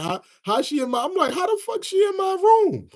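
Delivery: rising to a high, disbelieving yelp; shocked disbelief. Retold or imitated. Streamer retells finding her in his room, high shocked yelp. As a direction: act out the shock of seeing her in your room.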